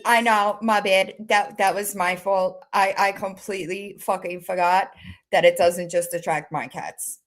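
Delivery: ordinary delivery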